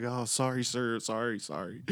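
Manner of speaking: light, hurried-to-please retold apology; freaked-out apology. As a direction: imitate flustered kids apologizing. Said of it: "they're freaking out".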